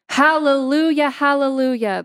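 high, ringing hallelujah cry; narrated prayer-meeting shout; joyful shout. A narrator's reading. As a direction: shout praise, pitched well up. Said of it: "the prayer woman shouts".

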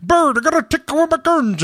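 panicked gun owner. Retold or imitated. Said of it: "people you're like".

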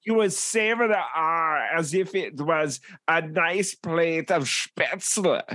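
hammed-up German accent, relishing the food; mock German accent.

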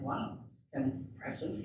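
soft unvoiced whisper; whispered to herself, awed; whispered aside. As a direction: murmur it under the breath, impressed. Aside during surgery, whispered.

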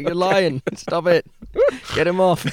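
high, loud squawking heckler; bird heckling. Pretend. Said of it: "he's like".

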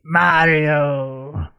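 lightly voiced Mario; Mario impression. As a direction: toss off a Mario line. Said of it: "It's a me".

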